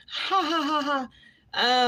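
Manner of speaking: loud high cackling laugh; burst of laughter. Real, not acted. Laughing off a viewer who asked for a house tour. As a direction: cackle loudly at the request.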